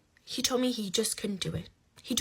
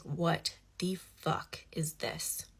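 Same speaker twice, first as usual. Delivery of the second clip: drawn-out, softer stunned question; slow disbelief; 3 semitones lower, 5 dB quieter, much slower than usual. Retold or imitated. Speaker retells finding his secret contact, slow disbelief. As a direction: space the words out in quiet disbelief. Reacting to the discovered call.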